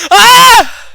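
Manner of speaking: sudden high loud yelp; startled scream. Real, not acted. Reacting to the poem, a shocked high scream. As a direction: shriek in shock.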